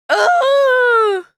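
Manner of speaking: high, loud sob; crying oh. Real, not acted. Missing friends, crying aloud. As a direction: wail it out. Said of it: "I'm a big sap".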